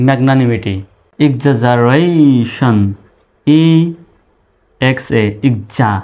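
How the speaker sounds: "exaggeration" very slow, syllables stretched out; drawn-out syllables. Shown as a demonstration. Teacher introducing a long word to learners, drawn out by syllable. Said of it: "ভেঙে ভেঙে উচ্চারণ করার".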